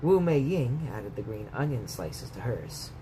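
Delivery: plain reading voice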